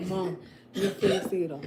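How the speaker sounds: slow, lightly voiced son's protest; a hint of a whiny kid; imitating her son. Mother mimics her son complaining to her, mock whine. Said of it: "you know how you be like".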